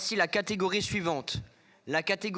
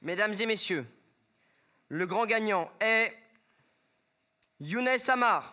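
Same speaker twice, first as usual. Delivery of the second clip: booming prize announcer; 2 semitones higher than usual. Acted. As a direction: announce the winner with a dramatic pause. Awards host reveals the winner.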